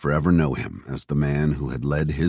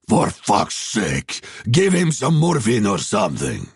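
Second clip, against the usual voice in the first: raised, exasperated order, starting very slow; angry commander; 6 semitones higher, slower than usual. A narrator's reading. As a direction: snap an order as the fed-up commander. Stepan orders soldiers, voiced in story.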